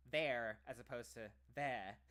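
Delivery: British "There" first without the R, then the American "there" with the R, slowly; British versus American there. Shown as a demonstration. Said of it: "They don't even say the R".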